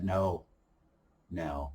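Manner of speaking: quiet no. Retold or imitated. They said no, quiet.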